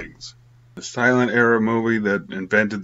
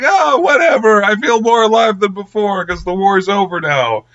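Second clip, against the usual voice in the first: put-on giddy boyfriend; 8 semitones higher, 4 dB louder than usual. Blind boyfriend cheerfully shrugs off war.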